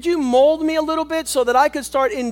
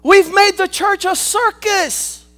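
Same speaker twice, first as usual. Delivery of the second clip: full-force preaching; 5 semitones higher, 6 dB louder than usual. Acted. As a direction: preach it hard to the congregation.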